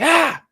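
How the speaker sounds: high sharp yelp of pain, replayed loud; sudden pained shriek, reenacted; retold scream. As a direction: yelp as if stung. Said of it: "I've never hear you scream".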